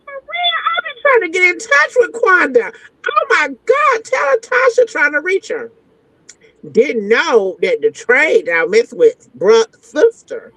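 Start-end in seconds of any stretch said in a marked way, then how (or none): 0.0-5.7 very high, gushing fake delight; mock-excited. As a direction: fake your excitement to get the info. Feigned excitement on phone.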